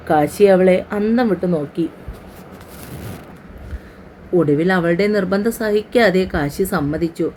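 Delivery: ordinary delivery, nothing marked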